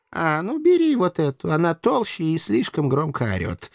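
lightly affected indifference; offhand master. A narrator's reading. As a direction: hand over a victim breezily. Master offers the louder one.

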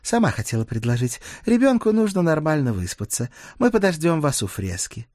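Dana, agreeable. Acted. Dana agrees to take the child along, practically.

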